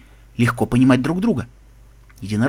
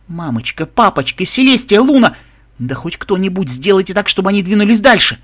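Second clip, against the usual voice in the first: desperate, rising, louder pleading; frantic plea; 5 semitones higher, 4 dB louder than usual. Acted. Captive begging that the guards move on, frantic pleading. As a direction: build from a small plea to a loud, high cry.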